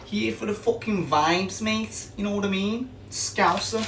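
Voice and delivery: high-pitched mock Scouser; slow, high, hammed-up Scouse; attempted Scouse accent. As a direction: put on your best bad Liverpool accent, pitched right up. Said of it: "This is the best accent I can do".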